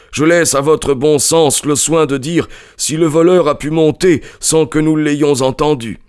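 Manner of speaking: high-pitched, impatient character voice; put-upon householder protesting. A narrator's reading. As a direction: object impatiently, voice pitched well up. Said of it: "non sans impatience monsieur Cunningham".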